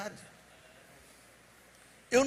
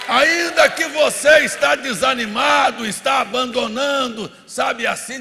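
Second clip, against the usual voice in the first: raised, driving pulpit voice; fervent preaching; 4 semitones higher, 10 dB louder than usual. Real, not acted. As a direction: exhort the congregation with rising fervour. Preaches at full force.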